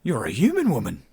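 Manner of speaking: startled Australian outburst; flustered Australian. A narrator's reading. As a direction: exclaim in flustered disbelief, Australian accent. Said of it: "clearly flustered but obviously Australian".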